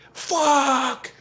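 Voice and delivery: loud, high, drawn-out mimicked curse; pained yell. Father whacked by the fly swatter, pained yell. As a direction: howl it out as if just whacked. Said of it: "he'll yell".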